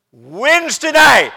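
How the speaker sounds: high, loud, drawn-out preacher's cry; emphatic shout. Real, not acted. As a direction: shout it slowly to drive it home. Repeats it for emphasis to congregation.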